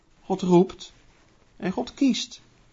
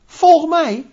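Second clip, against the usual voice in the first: voicing Christ; 10 semitones higher, 5 dB louder than usual. Christ calls the disciples.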